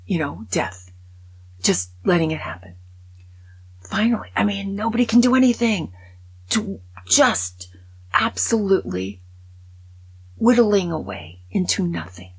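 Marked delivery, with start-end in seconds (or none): none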